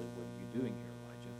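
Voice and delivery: barely above a breath, tender; gentle voice. Acted. God gently asks Elijah, hushed and soft. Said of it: "A voice came to him and said".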